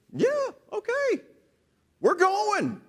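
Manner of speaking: high, breezy, carefree agreement; mock-casual Israelites leaving; bold and breezy. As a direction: toss it off like it's nothing.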